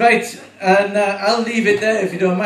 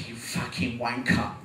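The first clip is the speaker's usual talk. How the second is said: London sat nav; 7 semitones lower, 6 dB quieter than usual. Retold or imitated. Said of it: "it'd be like".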